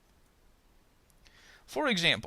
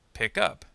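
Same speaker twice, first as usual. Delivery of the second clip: "Pick up" with the weight on UP; stress on particle; 4 semitones lower than usual. Shown as a demonstration. Teacher gives phrasal verb example, "Pick up" stressed on UP. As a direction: lean on UP in "Pick up". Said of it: "on the particle of the phrasal verb".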